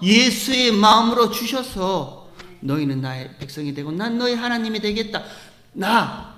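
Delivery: loud, ringing sermon climb; full-force preaching. Acted. Pastor calling out to the congregation, full-force preaching. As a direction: preach it out with full conviction to the church.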